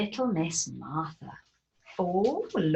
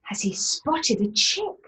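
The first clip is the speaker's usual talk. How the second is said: plain reading voice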